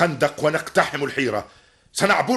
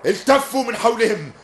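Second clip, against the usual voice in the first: shouted commands; 11 semitones higher than usual. Acted. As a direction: bark orders over the fight. Commander shouting orders in battle.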